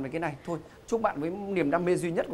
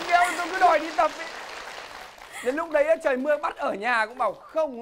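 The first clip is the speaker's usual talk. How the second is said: excited; 12 semitones higher, 4 dB louder than usual. Real, not acted. Host jokes about gym, high.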